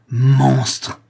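loud, drawn-out cry of fury; outraged exclamation. A narrator's reading. Avenging prince advances on the notary, loud outraged cry. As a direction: hurl it as an accusation. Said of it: "s'écria Rodolphe".

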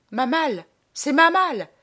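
rising outcry of dismay; shocked recognition. Acted. Traveller spotting his own trunk in the coffin, shocked cry.

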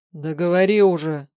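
loud, exasperated order; impatient demand. A narrator's reading. Man awaiting test results, loud and impatient. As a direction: push him hard to get on with it.

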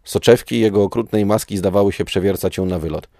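plain reading voice

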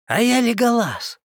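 high, loud sudden shout. Acted. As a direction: call it out from afar. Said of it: "вдруг раздался крик".

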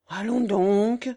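loud, drawn-out disbelief; stupefied exclamation. A narrator's reading. Countess hears her son frightens the young woman, stupefied. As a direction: cry it slowly, as if astounded. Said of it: "s'écria la comtesse, comme stupéfaite".

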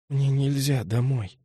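quiet, slow and emotionless; numb. A narrator's reading. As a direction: say it with nothing left. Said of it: "тем же голосом без эмоций".

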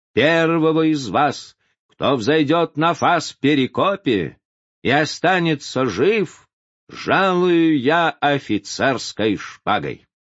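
slow, loud commanding address to troops; rally the soldiers, every word ringing out; roaring rallying speech. A narrator's reading. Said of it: "обратился к войскам".

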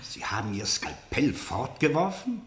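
hushed, disbelieving question; soft, sceptical whispered question; incredulous whisper. Acted. Interrogator doubting the suspect's claim.

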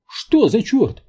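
very high, loud startled yelp; panicked squeal. A narrator's reading. Паунд reacts to the loud bangs, squealing. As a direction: squeal it in alarm. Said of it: "взвизгнул Паунд".

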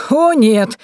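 a touch of amusement; chuckling. A narrator's reading. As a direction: call it out with mock dismay. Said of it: "хохотнула Варвара".

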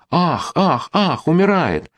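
high alarmed ah-ah, hammed up; Мещерский mimics the family fussing over him: high mock alarm. Family fussing over fainted man.

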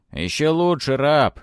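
high, loud command; slave driver's shout. A narrator's reading. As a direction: yell at your slave. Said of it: "услышал он голос Сунга".

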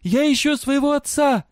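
high, loud cry to a crowd; shouting. A narrator's reading. Girl searching for her father, shouting. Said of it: "кричала она".

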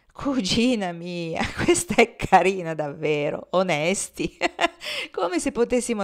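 laughing through the words at his cousin. Acted. Cousin asks if slaves are honest, he laughs. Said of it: "Agostino diede in una risata".

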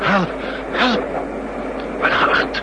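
high, loud gasped cries for help; desperate, put-on cries for help; faked heart attack cries. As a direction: feign a heart attack and call out breathlessly. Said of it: "een gesimuleerde hartaanval".

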